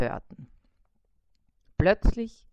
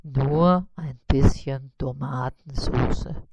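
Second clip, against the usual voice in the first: lower, slow, modest spider voice; 6 semitones lower, 5 dB louder, slower than usual. A narrator's reading. Spider naming his modest order, low and slow. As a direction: say it low and slow, almost shy. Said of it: "antwortete Karl-Heinz".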